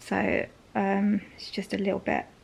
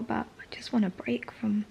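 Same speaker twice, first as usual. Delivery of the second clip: ordinary delivery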